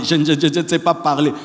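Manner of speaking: quick mock stutter on the opening word; imitating stammering Moses. Moses protesting at his calling that speech fails him, mock stammering. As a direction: trip over the first word, then get it out. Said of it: "ton bégaiement est une invitation".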